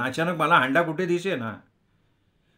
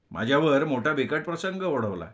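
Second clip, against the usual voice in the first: ordinary delivery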